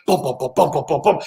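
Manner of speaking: steady thudding drum beat; imitated bass drum. Shark documentary soundtrack, imitated drum beat. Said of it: "bass drum beat, just going".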